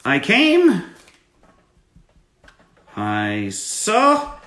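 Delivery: loud, drawn-out character punchline with high outbursts; loud, slow in-character quote for a fan; Wrench voice. Acted. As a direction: ham up the catchphrase as the unhinged hacker. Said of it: "my favorite quote from Wrench".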